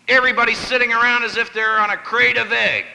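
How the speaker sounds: ordinary delivery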